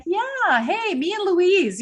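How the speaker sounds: high, slow, teasing brag; grinning fake swagger; playful mock boast. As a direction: toss off a cheeky "me and her". Playful boast about Louise Hay.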